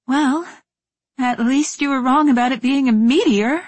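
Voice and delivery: forced cheerful quip; bright, brave joking tone; jaunty tone. Acted. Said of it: "I tried for a jaunty tone anyway".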